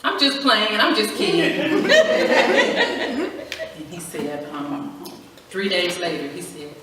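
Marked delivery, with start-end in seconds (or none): 0.0-1.9 laughing it off. Retold or imitated. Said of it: "And I was like". 1.9-3.7 a high squealing laugh at her own joke. Real, not acted. To the audience about her husband, a high squealing laugh.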